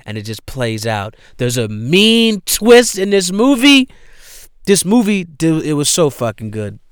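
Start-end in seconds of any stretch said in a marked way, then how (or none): 1.4-6.8 high, loud burst of excitement; real excitement about the twist; excited rave. Real, not acted. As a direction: blurt it out, thrilled. Raving about the film's twist.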